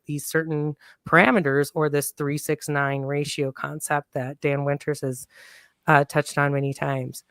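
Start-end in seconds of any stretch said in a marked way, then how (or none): none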